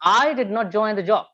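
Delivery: "I" pitched high, then "did not join the job" after it; stress on I. Shown as a demonstration. Teacher showing stress changes meaning, stress on I. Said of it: "the stress changes the meaning".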